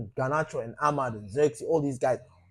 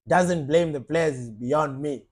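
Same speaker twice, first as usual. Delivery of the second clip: ordinary talk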